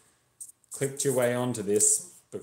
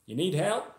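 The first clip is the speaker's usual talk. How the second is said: higher-pitched Texan stranger offering help; friendly passing driver; 10 semitones higher than usual. Acted. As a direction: ask helpfully, voice lifting high.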